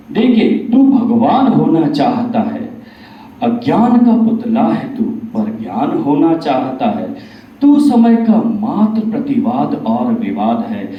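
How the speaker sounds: forceful declamation, hitting each line's opening; Bhagwan in recited verse. Acted. Bhagwan rebuking Kaliyug for wanting godhood, emphatic declamatory recitation. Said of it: "यहाँ भगवान कलयुग से बताते हैं".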